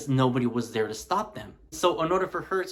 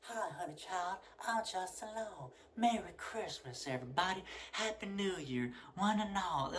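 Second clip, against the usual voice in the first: soft higher Southern accent impression, mocking a miscast actor; 5 semitones higher, 9 dB quieter than usual. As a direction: coo it in a hushed, sugary Southern accent. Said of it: "soft-spoken southern accent, just like this".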